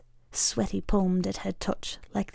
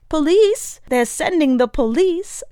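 high, loud alarmed shout; old activist warning the room, loud and high; alarmed cry; 8 semitones higher, 6 dB louder than usual. Acted. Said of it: "cries Pinkstaff".

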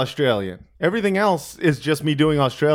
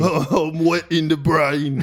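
slow dopey boxer voice; mock Mike Tyson; much slower than usual. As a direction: slur it out slowly like a dazed boxer. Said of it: "became a bit Cockney there".

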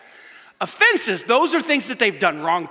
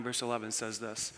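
ordinary talk, nothing marked

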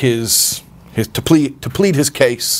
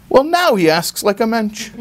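mother's remark; 10 semitones higher than usual. Retold or imitated. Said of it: "And she says".

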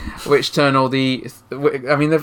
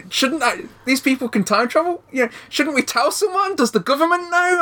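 growing amazed disbelief; rising incredulous excitement; 11 semitones higher than usual. Retold or imitated. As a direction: pitch climbing higher with each question. Retelling disbelief at time travel.

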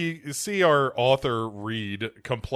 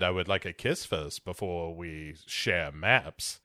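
a low, slow come-on; low, slow flirt; 8 semitones lower, 7 dB quieter than usual. Retold or imitated. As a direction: drop low and slow for the ask. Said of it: "And he's like".

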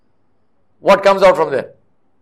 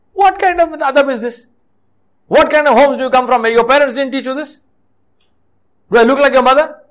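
higher-pitched, reproachful rhetorical questions; indignant scolding; 4 semitones higher than usual. Acted. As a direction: scold them like an exasperated elder. Preacher scolds the seated youngsters.

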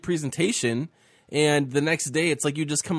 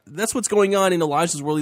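ordinary talk, nothing marked

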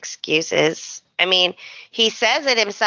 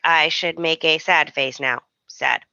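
low, emotionless robot imitation; deadpan robotic readout, pitched low; mock robot; 5 semitones lower than usual. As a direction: announce each expression like a machine. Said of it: "almost like a robot".

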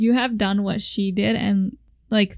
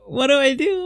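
imitating a flustered question, a bit higher and louder; first worried ask; mild panic; 4 semitones higher, 4 dB louder than usual. Picturing seeing road rage, mildly panicked. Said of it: "I would be like".

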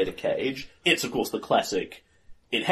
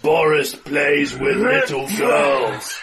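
brute voice; 3 semitones higher, 6 dB louder, much slower than usual. Acted. Player voicing brute Boris.

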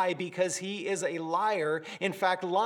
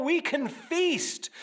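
higher and slow, savouring "feast"; excited feast; 8 semitones higher, slower than usual. Shown as a demonstration. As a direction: relish the abundance in the words. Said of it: "It's not the abundant buffet table".

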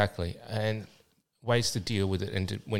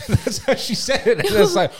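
laughing out loud, higher, while telling it; 13 semitones higher, 9 dB louder than usual. Real, not acted. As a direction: laugh through the line at the memory. Laughing while retelling story.

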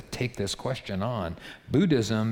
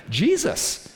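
ordinary delivery

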